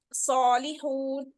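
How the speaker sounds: सॉलिहून drawn slowly with heavy सॉ; slow bold reading. Shown as a demonstration. Teacher reading सॉलिहून from the Quran, slow. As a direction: stretch it, heavy first syllable. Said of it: "हम read करते हैं".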